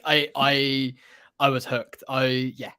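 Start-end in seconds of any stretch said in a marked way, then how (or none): none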